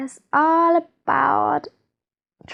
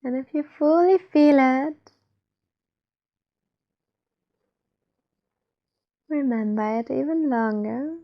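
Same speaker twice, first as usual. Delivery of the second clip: guided meditation. Acted. Guide leads listeners to feel trust inside, guided meditation. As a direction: ease the listener inward, unhurried.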